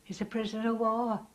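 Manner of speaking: cheerful relief. Retold or imitated. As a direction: lift the voice, glad he is alive. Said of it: "What are you looking so cheerful about?".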